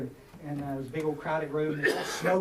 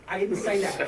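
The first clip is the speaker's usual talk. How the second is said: said through a laugh; laughing, louder denial; 2 semitones higher, 5 dB louder than usual. Real, not acted.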